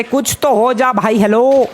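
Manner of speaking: high-pitched, impatient appeal; exasperated urging. Real, not acted. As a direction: call out to get some response. Urging the hammer to work.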